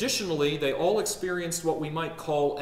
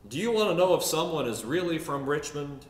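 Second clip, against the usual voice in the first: put-on Richmond Southern accent; 3 dB louder than usual. Native explains Richmond to the preacher, Richmond Southern accent. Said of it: "One native once said to me".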